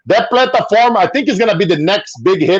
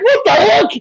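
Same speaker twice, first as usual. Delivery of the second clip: surprised; 11 semitones higher than usual. Retold or imitated. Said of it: "I was like".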